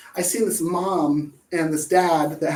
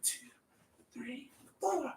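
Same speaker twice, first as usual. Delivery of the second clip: whispered count; 5 semitones higher, 18 dB quieter than usual. Retold or imitated. Counting from the song.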